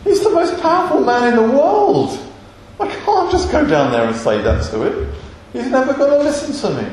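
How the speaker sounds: high-pitched comic disbelief with swooping pitch; incredulous protest. Acted. Moses objecting to God about facing Pharaoh, incredulous. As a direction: protest as if the errand is absurd. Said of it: "Moshe at that point is like".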